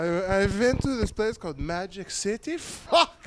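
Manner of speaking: high mock Swedish accent; freaked-out student in a put-on Swedish accent; Swedish kid accent. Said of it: "Just some fucking Swedish".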